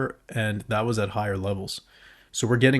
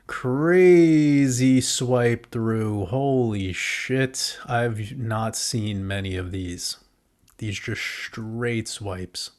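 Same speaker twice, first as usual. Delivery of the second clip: slow, drawn-out amazement; awed and slow; 3 dB louder, much slower than usual. Real, not acted. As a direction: stretch each word in awe. Awed at the chart.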